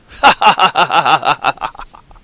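loud, rapid-fire cackle; big hammed-up staccato laugh; Jim Carrey laugh. Shown as a demonstration. Zombie host after threatening to eat faces, loud comic cackle. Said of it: "Jim Carrey laugh".

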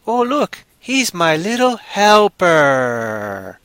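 high cooing, last word drawn out; sweet mock-adoring voice, slowing down; doting zombie owner. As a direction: coo over your little pet helper.

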